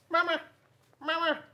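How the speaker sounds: very high, chirpy gosling calling Mama; baby goose voice. Acted. Goslings calling after grumpy bear Bruce, high and chirpy. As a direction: pipe it up like a tiny gosling calling its mother. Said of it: "all they said was".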